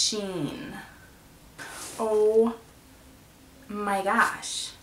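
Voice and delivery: ordinary delivery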